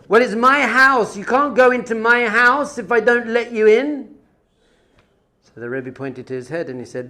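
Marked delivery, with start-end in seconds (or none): 0.1-4.1 high, loud protest; retold indignation. Villager telling the rebbe it is his house, indignant. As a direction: insist with raised, acted outrage.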